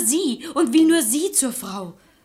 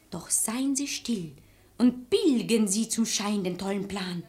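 whisper; 3 semitones lower, 6 dB quieter than usual. Acted. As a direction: whisper the secret. Conspiratorial secret plan.

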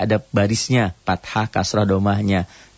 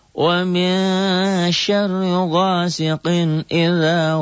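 very slow, high melodic tartil chant; Quran recitation; 8 semitones higher, much slower than usual. Shown as a demonstration. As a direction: recite the surah in full tartil. Recites Surah al-Falaq. (tilawah tartil yang sangat pelan dan merdu)

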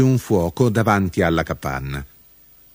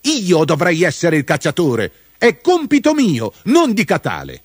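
high-pitched, indignant claim; irate; 9 semitones higher, 3 dB louder than usual. A narrator's reading. Mudube claims the hunter's role from Catale, irate. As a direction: insist angrily, pitch high throughout. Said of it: "fece con ira Mudube".

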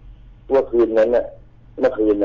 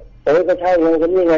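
insistent retort; 8 semitones higher, 4 dB louder than usual. Retold or imitated. Confirming, heated.

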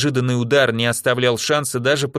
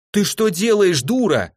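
hysterical scream; 8 semitones higher than usual. Acted. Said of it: "в истерике закричала ей Жозе".

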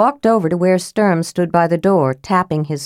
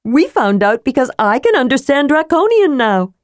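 put-on higher, eager young woman voice; Tika's voice; 8 semitones higher than usual. Tika bursting in to tell the news, higher voice. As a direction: voice her bright and keen.

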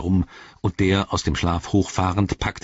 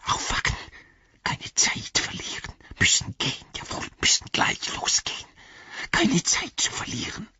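whispered, hissing creature urgency; Gollum; 3 dB quieter than usual. A narrator's reading. Gollum urges the sleepers to leave at once, whispering. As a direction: whisper as if time is running out. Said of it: "flüsterte er".

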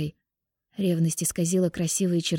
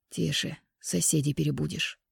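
hushing, amused; 2 semitones lower, 6 dB quieter than usual. A narrator's reading. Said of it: "Данила, смеясь".

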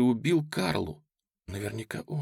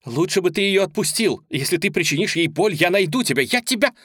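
high, loud desperate threat; furious threat; 8 semitones higher, 6 dB louder than usual. Acted. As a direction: shout her threat down the line. Julia threatens the kidnapper.

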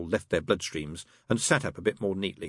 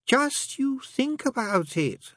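slow, much higher, insistent push; relentless; much slower than usual. Acted. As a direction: press on without mercy. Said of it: "said Crowley relentlessly".

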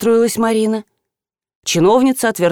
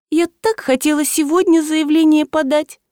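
high, wistful whine; тоскливо протяжно; 8 semitones higher than usual. A narrator's reading. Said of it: "тоскливо протянула Марина". (тоскливо, высоким голосом, нараспев)

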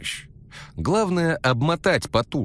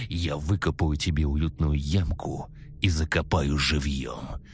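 creepy maniac; 5 semitones lower than usual. Acted. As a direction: purr it like a psycho. Maniac monologue.

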